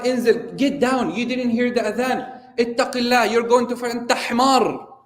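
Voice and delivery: retold angry shout mixing Arabic and English; harsh shout. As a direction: bark it down at the man in the tree. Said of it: "yeah, he shouted at him".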